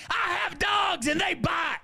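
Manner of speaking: high-pitched threatening holler; hammed-up shrieking neighbor lady; shouted warning. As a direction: scream a warning to back off. Neighbor warns off raging mom.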